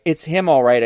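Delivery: usual speaking voice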